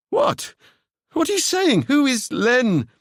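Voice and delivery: very high, shocked questions. Acted. Seb alarmed at the name, very high and shocked. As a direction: jump up in alarm.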